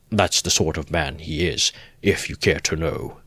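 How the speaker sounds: usual speaking voice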